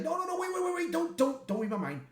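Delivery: high, rushed panicky begging; panicked pleading. Retold or imitated. Pyro begs not to be mind-read.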